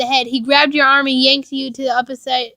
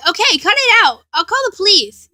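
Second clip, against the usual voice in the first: higher-pitched, lightly raised voice; an understated narrated shout, pitched up; mild harsh shout; 6 semitones higher than usual. A narrator's reading. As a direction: read it as a mild, high protest. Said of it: "you shouted harshly".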